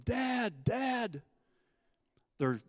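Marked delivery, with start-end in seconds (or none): 0.0-1.2 high, loud calls for dad; mock teenage yelling; imitating boys yelling. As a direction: shout up for their father from the cliff. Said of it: "they both start yelling".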